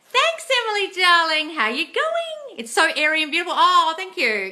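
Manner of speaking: high, sing-song gushing thanks. Real, not acted. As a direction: coo your thanks at a fan. Gushing thanks to live commenters.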